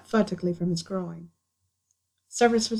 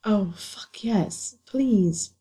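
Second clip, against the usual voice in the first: gasped; much slower than usual. Acted. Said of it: "Harry gasped".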